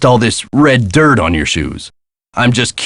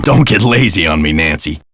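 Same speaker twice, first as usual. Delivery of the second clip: ordinary talk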